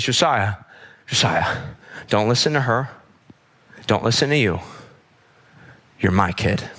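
low, unhurried comfort with long pauses; settled, reassuring mom. Retold or imitated. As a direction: gently reassure an upset child. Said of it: "she, like, settles down".

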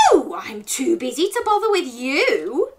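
loud, high, swooping rebuff; mock-indignant storybook brush-off; snappish gingerbread man. A narrator's reading. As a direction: refuse crossly in a character voice. Gingerbread man rebuffs wolf, slams door.

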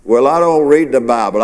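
ordinary talk, nothing marked

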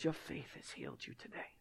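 soft whispered blessing; tender whisper. Pretend. Jesus gently telling the healed woman, whispered. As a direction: breathe the words gently to her.

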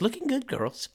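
high sleazy quote. Retold or imitated. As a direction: camp it up high and cheesy. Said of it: "was like".

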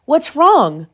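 high, mildly alarmed question; alarmed cry. A narrator's reading. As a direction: ask with a touch of storybook worry. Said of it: "she cried".

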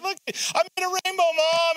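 put-on high, childlike call to mom; proud small boy showing off to mom; little kid. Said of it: "and be like".

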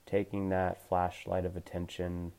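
gentle meditation coach voice; guided meditation. Acted. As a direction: ease them into calm awareness, unhurried. Leads a guided body scan.

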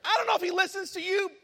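loud, high indignant complaint; mad, retold. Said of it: "I'm just mad about it".

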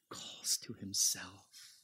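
soft, slow unvoiced whisper. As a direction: breathe the last words softly. Preacher whispers the promise.

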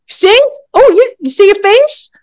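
teasing the cohost's cringing face; gleeful teasing. Real, not acted. Teasing the cohost about her face, gleeful and loud.

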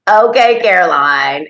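put-on loud, high-starting little-boy reply; mock sassy child, pitch dropping off; imitated cheeky kindergartner. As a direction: play a kid breezily defying the teacher. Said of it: "And he'd go".

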